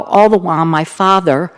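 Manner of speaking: ordinary talk, nothing marked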